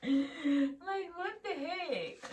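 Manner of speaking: high, slow mock exasperation; drawn-out, tickled imitation; laughing disbelief. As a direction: squeal it through laughter. Laughingly voices instructor's disbelief.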